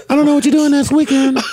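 a hint of a girl voice; man doing a woman's voice. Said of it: "You gotta do the girl voice".

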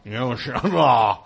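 slow, hammy Johnny Carson voice; bad impression. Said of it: "he just can't not be huge".

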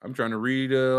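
ordinary delivery

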